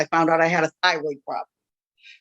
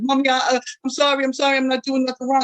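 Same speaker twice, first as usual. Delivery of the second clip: higher, lightly voiced scared little boy; pleading child; 5 semitones higher, faster than usual. Sick son begging his mother during IVs, lightly voiced child pleading. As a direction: beg like a frightened child, a bit higher.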